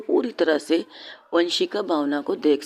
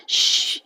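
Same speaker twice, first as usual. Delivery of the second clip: hushing; much slower than usual. Acted. Vanshika hushing Bhavna.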